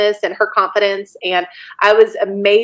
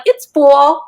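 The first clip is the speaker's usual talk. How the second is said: louder, higher mock New York accent; 4 semitones higher, 4 dB louder than usual. New York couple answering about the weather, imitated accent. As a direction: imitate a Brooklyn local answering. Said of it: "a couple that was from New York".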